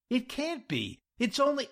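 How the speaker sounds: high-pitched, alarmed disbelief; panicked protest. Acted. Jack hearing the client knock too early, panicked.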